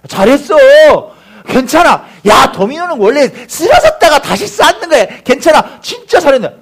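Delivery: high, loud, emphatic encouragement; rally a crying child in acted voices; cheering on. Church elders cheer up the crying boy, cheering on. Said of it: "응원하는 거예요".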